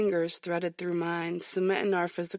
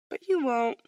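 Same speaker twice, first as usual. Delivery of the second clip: coy, high and louder; teasing certainty; 7 semitones higher, 5 dB louder than usual. Acted. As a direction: tease him, sure of yourself. Teasing confident reply.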